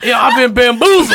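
normal talking voice

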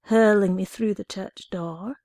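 usual speaking voice

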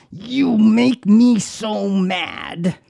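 hammed-up angry spouse, slow and high; mock exasperated accusation. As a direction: play the fed-up spouse, stretching every word. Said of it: "Well, Rick, calm down".

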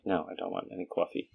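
retold quiet grumble in New York accent. Answering mom about coffee, soft New York accent. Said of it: "do it right when you imitate the accent".

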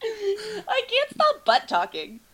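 slow, high, laughing whine; laughing mock whine. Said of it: "Bad grammar".

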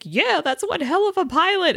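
high, awed fan voice; impressed Finn. Retold or imitated. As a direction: gush like a starstruck fan. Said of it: "Finn's just like".

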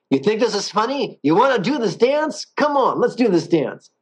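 mock an angry guy from New Jersey picking a fight. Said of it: "They turn into an angry guy from New Jersey".

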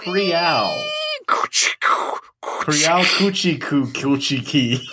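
drawn-out squeaky door sound; slow door creak noise. Pretend. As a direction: slowly creak like a van door. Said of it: "I love doing onomatopoeias in my clear announcer voice".

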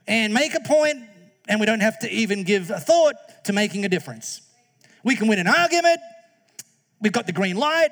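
high, loud mock enthusiasm; over-the-top ironic cheer; sarcastic glee. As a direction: gleefully pretend it's good news. Sarcastic fake endorsement.

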